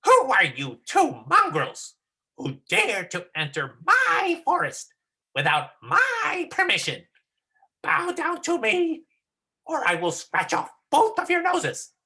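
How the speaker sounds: high, loud, slow menacing monkey; hammed-up threat from a forest monkey, word by word; dramatic monkey. Shown as a demonstration. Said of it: "I think you can read a little bit slower".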